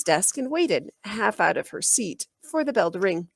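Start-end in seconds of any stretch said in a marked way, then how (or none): none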